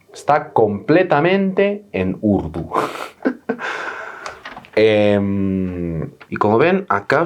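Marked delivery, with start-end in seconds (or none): 2.7-4.7 risita de aire, incrédula; ríete en silencio del papel; risa soplada sin voz. Real, not acted. Risa al ver documento en urdu. (risa ahogada al ver el documento en urdu)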